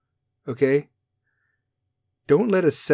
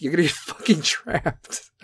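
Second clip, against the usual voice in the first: laughing through it; 3 semitones higher, 3 dB quieter than usual. Real, not acted. Repeat laughing.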